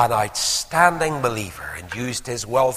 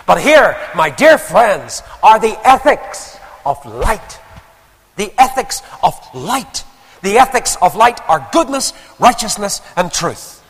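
high, loud pulpit proclamation; ringing, deliberate sermon climax; full-force preaching; 9 semitones higher, 10 dB louder than usual. Real, not acted.

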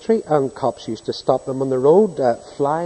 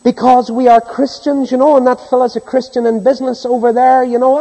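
forceful preaching; 10 semitones higher, 8 dB louder than usual. Real, not acted. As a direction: thunder it at the congregation. Preacher mocks Christians' presumption.

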